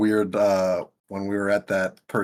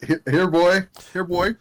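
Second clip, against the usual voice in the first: high, louder pet call; calling a dog; 10 semitones higher, 6 dB louder than usual. Pretend.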